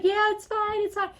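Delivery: very high-pitched mock reassurance; hasty reassurance. Doctor answering nurses at the door, hastily reassuring. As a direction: wave them off in a high voice.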